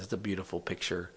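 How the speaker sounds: ordinary delivery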